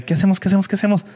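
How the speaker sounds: high, repeated urgent questions; mock panic. Friends wondering what to do with injured man, panicked. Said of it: "Y fue de".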